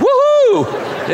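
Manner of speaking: a high, loud woo-hoo cheer; excited celebratory whoop; joyful whoop. Real, not acted. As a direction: whoop like you just won. Celebrating the answer.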